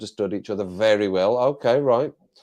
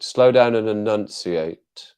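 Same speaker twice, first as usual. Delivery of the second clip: very slow with DOWN punched; slow, enunciated; 4 dB louder, slower than usual. Shown as a demonstration. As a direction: spell out each word slowly, ENUNCIATE fully articulated.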